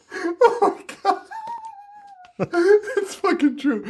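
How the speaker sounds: high, slow, laugh-choked; real laughter at the joke; laughing hard. Real, not acted. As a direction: wheeze it out mid-laugh. Cracking up at realization.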